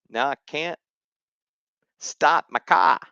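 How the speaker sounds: Boston accent, no r in car. Shown as a demonstration. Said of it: "I'm gonna do my best Boston impression".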